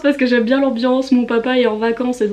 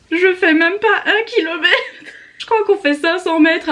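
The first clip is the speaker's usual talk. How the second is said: high, slow laughing confession; 7 semitones higher than usual. Real, not acted. Vlogger laughing at herself to viewers about her running. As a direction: laugh it out at yourself. Confessing she barely runs.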